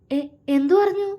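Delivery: high, stammering panic; flustered. A narrator's reading. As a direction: blurt it out in alarm. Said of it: "അവൾ വെപ്രാളത്തോടെ ചോദിച്ചു".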